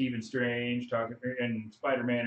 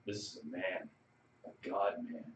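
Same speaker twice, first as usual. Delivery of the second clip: soft, low reverent voice; Drax; 5 semitones lower, 10 dB quieter than usual. Retold or imitated. Imitating Drax admiring Thor, reverent and low. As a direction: lower and hush it in mock awe. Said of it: "Drax is like".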